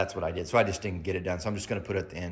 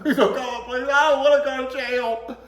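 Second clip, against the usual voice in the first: put-on much higher, louder pleading kid; crying plea; 8 dB louder than usual. Brother begging not to go to jail, mock crying plea. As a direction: beg tearfully. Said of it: "breaking down, crying".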